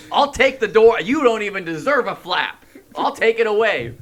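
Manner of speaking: high, loud mock tirade; hammed-up parental threat; threatening parent. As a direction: yell it like an outraged parent. Parent threatening to remove tent door.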